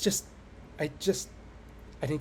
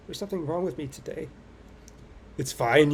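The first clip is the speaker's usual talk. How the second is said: ordinary delivery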